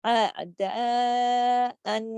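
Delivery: اعداءً drawn out very slowly; long madd recitation. Shown as a demonstration. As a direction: stretch the madd fully. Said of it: "مد بھی ہے". (اعداءً مد کے ساتھ لمبا)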